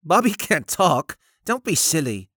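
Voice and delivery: put-on high boyish voice, lightly scoffing; a touch of scorn in a young boy's voice; mildly scornful boy. A narrator's reading. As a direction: brush off a silly question as a lad. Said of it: "Barnaby laughed scornfully".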